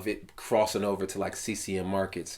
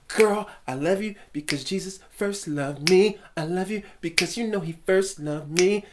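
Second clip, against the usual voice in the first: mock love-song serenade; 10 semitones higher, 3 dB louder than usual. Singer wooing his girl with Jesus talk, mock serenade. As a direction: croon it up high, hamming the devotion. Parodies overly spiritual love songs.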